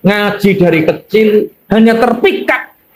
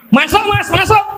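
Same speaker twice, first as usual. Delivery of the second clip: perempuan mempersilakan; 11 semitones higher than usual. Acted. Pelacur mempersilakan tamu masuk.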